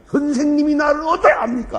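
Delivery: loud, high defiant challenge; defiant retort. Retold or imitated. Troubled student defying his teacher, loud retort. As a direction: throw it back at the teacher. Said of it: "이러는 거예요".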